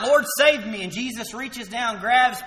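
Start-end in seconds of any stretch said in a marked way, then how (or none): 0.0-0.8 loud, high desperate cry for help. Retold or imitated. Peter sinking, calling out to Jesus, loud desperate cry. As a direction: call out like a sinking man. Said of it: "And he cries out".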